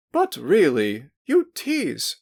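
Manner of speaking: playfully stern, voice jumping up high on tease; stern scolding that almost breaks; mock-stern scolding. A narrator's reading. Hostess scolding a teasing girl, mock-stern high. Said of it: "tried to say sternly".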